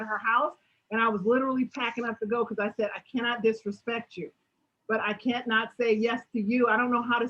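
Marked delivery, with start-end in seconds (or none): none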